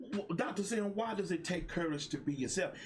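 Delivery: eager viewer asking. Retold or imitated. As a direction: play an eager follower asking Doctor Sim. Voices a viewer's question.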